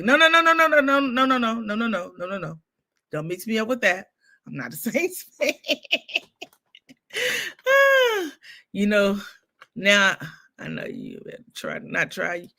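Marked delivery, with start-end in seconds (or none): 0.0-2.6 emphatic denial. Retold or imitated. Denying to the delivery men she's a Saints fan, emphatic. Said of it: "I be like". 7.1-8.3 a high laughing sigh. Real, not acted. Sighing after laughing about the Saints fan mixup.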